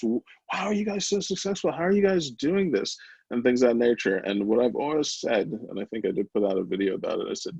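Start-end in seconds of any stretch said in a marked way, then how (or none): 0.0-3.0 high-pitched incredulous questioning; retold wonder, pitched right up; imitating amazed askers. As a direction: act out people marvelling. Retelling people's amazed questions.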